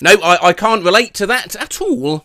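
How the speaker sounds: ordinary delivery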